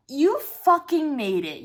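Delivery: "You fucking made it" slow, high and weighty; emphatic. Real, not acted. Insisting on the cuss word for graduating, "You fucking made it" hugely emphatic. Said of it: "needs that kind of emphasis".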